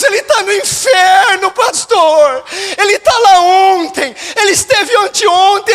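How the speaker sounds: high, loud wail acted from the pulpit; retold anguished crying. Girl telling pastor her grandfather is in hell, acted wailing. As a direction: imitate a distraught girl, crying out every phrase. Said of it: "Essa menina começou a chorar".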